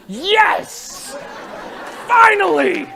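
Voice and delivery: high, delighted shout; joyful cry. Retold or imitated. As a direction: cheer as if finally rewarded. Girl brings bucket, joyful cry.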